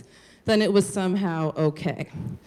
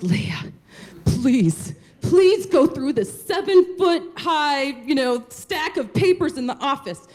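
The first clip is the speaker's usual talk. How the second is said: begging husband; 6 semitones higher than usual. Retold or imitated. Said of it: "he would beg".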